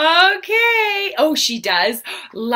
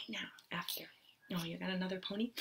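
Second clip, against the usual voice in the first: whispered aside; 8 semitones lower, 24 dB quieter, much faster than usual. Real, not acted. Aside to the child beside her.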